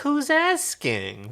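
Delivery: high, slow, teasing Chiana drawl; cheeky. Acted. Chiana mocking the lieutenant, high and cheeky. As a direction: tease him, slow and high. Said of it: "responded Chiana cheekily".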